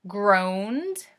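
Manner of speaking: ordinary delivery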